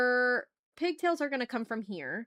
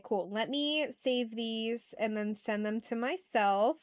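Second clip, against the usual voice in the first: usual speaking voice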